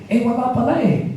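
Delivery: high, mock-surprised punchline; sarcastic retort. Ate Bel scoring a point on the minister, high and sarcastic. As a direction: toss it off with knowing sarcasm.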